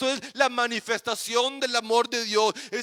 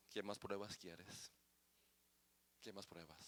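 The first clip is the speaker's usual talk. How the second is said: hushed Father's voice; 27 dB quieter than usual. Acted. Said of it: "me está diciendo".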